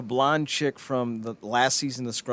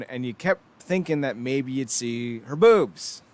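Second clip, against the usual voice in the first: ordinary talk, nothing marked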